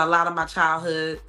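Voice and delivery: ordinary talk, nothing marked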